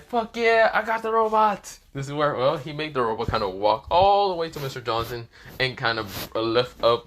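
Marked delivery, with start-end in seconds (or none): 0.0-1.8 high, loud victory cry; acted high-pitched cheer; triumphant shout. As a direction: call it out in excited triumph. Acted triumphant student calling out.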